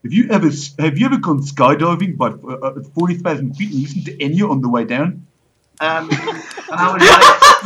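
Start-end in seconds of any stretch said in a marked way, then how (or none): 0.0-5.3 mock Afrikaans-accented delivery of an earnest line; thick Afrikaans accent. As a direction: mimic a South African bloke in a heavy Afrikaans accent.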